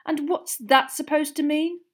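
high, loud affronted question. A narrator's reading. Girl challenging her friend's insinuation, affronted.

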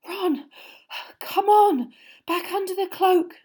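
high, loud, breathless urging; out of breath from running; panting. Acted. Hermione to Ron, panting after the sprint. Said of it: "Hermione panted".

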